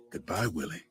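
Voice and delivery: a quiet, low sarcastic goodbye. A narrator's reading. Executioner saying goodbye to condemned Willie, understated sarcasm. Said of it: "sarcastic voice says".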